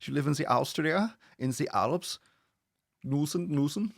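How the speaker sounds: put-on teasing Austrian-accented questions. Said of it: "I hear this accent all the time".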